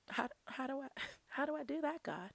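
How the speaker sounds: soft, high-pitched question breaking into laughter; baffled laughing question. Retold or imitated. Podcaster recounting her reply to God, baffled and laughing. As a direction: ask helplessly through a quiet laugh.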